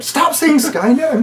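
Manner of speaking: high-pitched, drawn-out retold scolding; mock exasperated outburst. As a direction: imitate someone fed up with a friend. Jay's retold irritated outburst.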